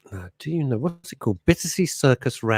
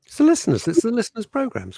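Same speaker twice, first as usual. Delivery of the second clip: emphatic high correction; 12 semitones higher than usual. Real, not acted.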